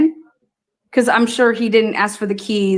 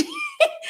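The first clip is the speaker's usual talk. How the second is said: high, breathy burst of real laughter; 7 dB quieter than usual. Real, not acted. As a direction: crack up mid-sentence. Breaks into laughter mid-story.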